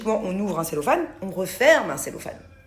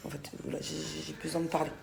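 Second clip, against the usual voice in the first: quiet; 5 semitones lower, 12 dB quieter than usual. Real, not acted. Confiding frustration.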